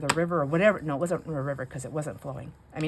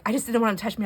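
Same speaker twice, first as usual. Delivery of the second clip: normal talking voice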